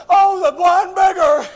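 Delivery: ordinary delivery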